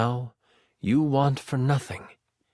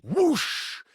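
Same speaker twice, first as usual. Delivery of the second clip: high, swooping "whoosh"; voiced whoosh; 12 semitones higher than usual. A narrator's reading. Narrator sounding the king's fall into the pyre, whooshing. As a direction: let the whoosh fly up high.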